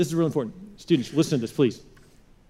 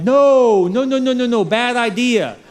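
higher, louder hammed-up parent no's; alarmed parents; 6 semitones higher, 6 dB louder, slower than usual. Pastor voices parents stopping their teen, hammed-up alarmed no's. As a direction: pile on the no's like a panicked parent. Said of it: "your parents are like".

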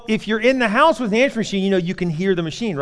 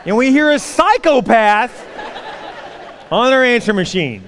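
indignant wife; 6 semitones higher, 4 dB louder than usual. Retold or imitated. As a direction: spell out her outrage word by word. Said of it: "She goes".

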